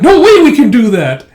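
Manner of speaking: high, loud cry of disbelief; loud protest. Retold or imitated. Workers told to carry ten boxes, loud protest. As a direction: protest as if the order is impossible.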